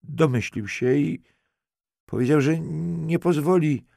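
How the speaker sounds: high, upset, acted near-weeping; breaking into tears. A narrator's reading. Halinka tells detective what her uncle did, tearfully. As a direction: let the voice climb toward tears. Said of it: "znowu zalała się łzami".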